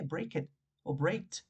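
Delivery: "breaked" said slowly as a wrong past form; "breaked" lento, como error que no se dice; forma incorrecta. Shown as a demonstration. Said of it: "según la lógica deberíamos ponerle E D". (pronunciando "breaked" despacio, como ejemplo equivocado)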